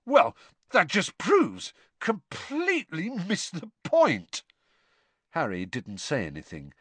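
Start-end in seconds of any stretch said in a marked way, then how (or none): 0.1-4.4 high, slow, indignant outburst; incredulous, drawn-out high-pitched protest; thunderstruck spluttering. A narrator's reading. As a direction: draw out each word in stunned outrage. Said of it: "he spluttered, looking thunderstruck".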